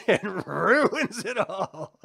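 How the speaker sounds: slow words broken up by real laughter; laughing. Real, not acted. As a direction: laugh your way through it, drawn out. Laughing at the monster's arrival.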